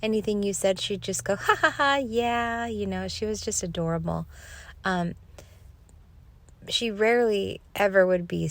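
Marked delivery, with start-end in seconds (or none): 1.4-2.7 imitating a little girl's high, bubbly laugh; higher mock giggle sliding into "yeah"; bubbly toddler giggle. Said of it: "just sweet and bubbly and laughed about everything".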